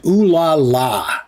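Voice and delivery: slow, drawn-out mocking ooh la la; mocking repeat. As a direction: sneer back at the cheesy line. Mocks the game's line.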